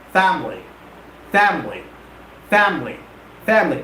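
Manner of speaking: "Family" as FAM-lee, repeated with pauses between; two-syllable family. Shown as a demonstration. Teacher drilling students, "Family" in two syllables. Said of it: "The right pronunciation is family".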